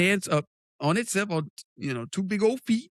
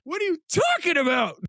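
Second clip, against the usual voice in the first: indignant shout; 13 semitones higher than usual. Real, not acted. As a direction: shout back, offended. Defensive yell.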